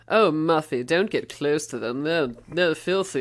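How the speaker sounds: lightly voiced snooty rich husband; mock condescending warning to a wife. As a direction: imitate a snob looking down on others. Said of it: "he's the snooty one".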